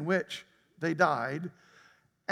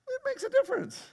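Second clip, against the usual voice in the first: comic squeak. Acted.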